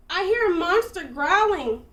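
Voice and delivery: high, loud alarmed cry; loud scared outburst; frightened cry. A narrator's reading. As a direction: cry out in fear. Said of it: "cried Dragon".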